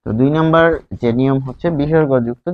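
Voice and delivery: ordinary talk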